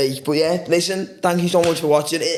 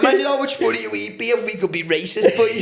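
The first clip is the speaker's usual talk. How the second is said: laughing; 7 semitones higher, slower than usual. Real, not acted. Joking while laughing.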